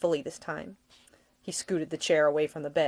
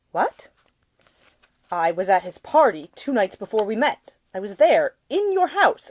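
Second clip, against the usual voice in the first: Nick; 3 semitones higher, 7 dB louder than usual. A narrator's reading. Nick's heated speech to Ida.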